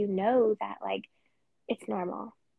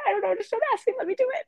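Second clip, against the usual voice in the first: much higher, louder, pleading; high, loud, half-crying plea to be left alone; tearful outburst; 12 semitones higher, 4 dB louder, much faster than usual. Retold or imitated. Wife answering husband, in a high, tearful plea. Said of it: "I'm like".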